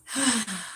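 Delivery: long exhale letting the tension go; acted-out sigh of relaxation; relieved sigh. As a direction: sigh as if instantly unwinding. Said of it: "I can be wound up as tight".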